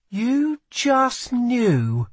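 high, drawn-out disbelieving echo; slow, high incredulity; incredulous echo. A narrator's reading. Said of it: "she echoed".

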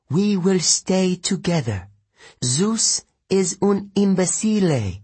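put-on high, defiant Italian-accented mother. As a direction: scorn Zeus in an Italian accent. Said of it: "She spoke with an Italian accent".

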